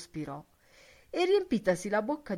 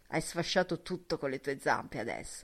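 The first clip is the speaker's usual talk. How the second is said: rough energy; 6 dB louder than usual. A narrator's reading. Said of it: "con ruvida energia".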